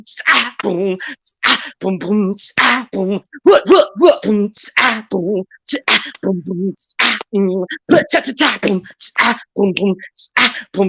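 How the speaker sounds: vocal drum beat with kicks and hi-hats; beatboxing. Acted. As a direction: lay down a beatbox groove. Beatboxing a rhythm.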